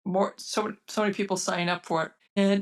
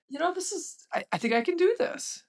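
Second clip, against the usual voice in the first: amazed disbelief; 11 semitones higher than usual. Retold or imitated. Telling husband she can work.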